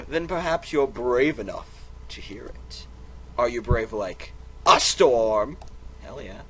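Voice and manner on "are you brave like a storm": slow, loud, rising heroic question; dramatic challenge. Acted. Narrator dares the listener, slow and loud. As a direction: challenge them like an epic hero.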